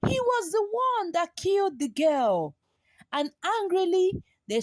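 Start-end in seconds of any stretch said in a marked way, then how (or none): 0.1-2.6 shocked realization. A narrator's reading. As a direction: cry out as the truth dawns. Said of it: "the warriors gasped".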